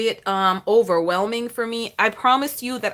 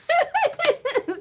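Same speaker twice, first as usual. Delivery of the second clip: sudden high-pitched giggle; laughing at her own joke. Real, not acted. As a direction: burst into a squeaky laugh. Laughs at side note.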